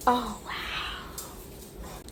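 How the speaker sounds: breathless whispered wow; awed whisper. Real, not acted. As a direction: whisper it in awe. Whispered awe at fridge.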